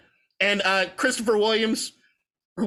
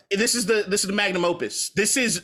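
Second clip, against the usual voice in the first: usual speaking voice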